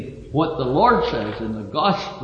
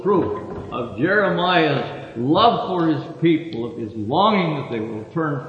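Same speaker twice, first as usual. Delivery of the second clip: ordinary talk, nothing marked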